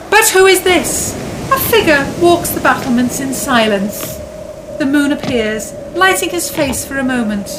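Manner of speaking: dramatic story narration. A narrator's reading.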